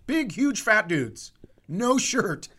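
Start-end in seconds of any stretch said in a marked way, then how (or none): none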